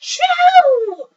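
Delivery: huge high theatrical achoo; giant mock sneeze. Reading to kids, the sleeping bear sneezes loudly. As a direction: blast out a big cartoon sneeze.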